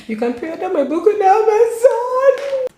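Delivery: high, loud excited call; excited high announcement. Real, not acted. Telling viewers the book is out for pre-order, a high loud excited call. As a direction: announce it with a thrill.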